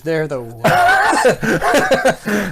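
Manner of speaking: laughing through the words. Real, not acted. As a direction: chuckle out the joke. Joking about long sermons.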